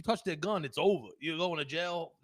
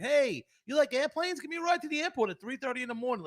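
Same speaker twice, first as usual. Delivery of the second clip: mock needy friend; 8 semitones higher, 3 dB louder than usual. Mocking the friend who wants an airport ride, high voice. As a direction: ham up the friend asking a favour.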